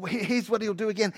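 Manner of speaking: ordinary delivery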